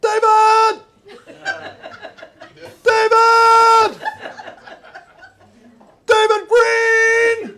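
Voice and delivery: long loud hollered name across the street; calling out. Retold or imitated. Comic calling across a parade to a friend. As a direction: holler it to a friend down the road, laughing. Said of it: "And I start going".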